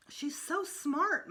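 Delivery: high, slow admiring mother voice; lightly voiced gushing mom. A narrator's reading. Mother praising the neighbor girl, high and slow.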